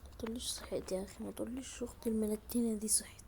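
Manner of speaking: worried whisper. Real, not acted. Player alone at night fearing sister woke, worried whisper. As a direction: whisper it so the sleeper won't hear. Fears sleeping sister has woken.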